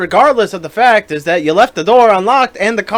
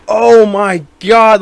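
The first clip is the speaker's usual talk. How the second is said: slow, louder gasped "Oh, my God"; retold alarm; 5 semitones higher, 8 dB louder, much slower than usual. Noticing the gas gauge on empty while driving, slow alarmed gasp. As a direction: drag it out in sudden alarm. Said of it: "I was like".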